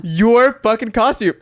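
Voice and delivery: high, laughing feigned innocence; laughing denial. Real, not acted. Denying while laughing.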